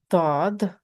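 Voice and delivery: "dod" with a thick, heavy D; heavy D sound. Shown as a demonstration. Teacher models letters for learners, heavy D. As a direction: raise the tongue back for "dod". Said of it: "it's thick or heavy sound".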